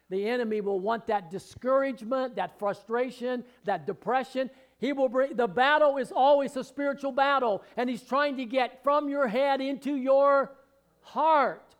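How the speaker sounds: building from firm to loud, high shouting; preaching crescendo. Acted. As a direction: drive it home with rising fervour.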